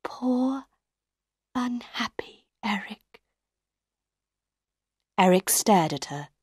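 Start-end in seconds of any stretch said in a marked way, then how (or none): 0.0-3.2 soft, high, drawn-out words; slow pity. A narrator's reading. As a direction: say it slowly and gently, pausing between words. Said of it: "said slowly".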